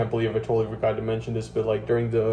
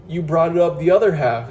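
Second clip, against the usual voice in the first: louder and higher, jabbing the accusation; angry accusation; 6 semitones higher, 7 dB louder than usual. Retold or imitated. Telling off the absent site leader, loud and pitched up. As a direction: throw the blame back at him.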